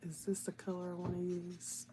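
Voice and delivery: whispered musing to herself; half-whispered question. Real, not acted. Muttering while choosing color.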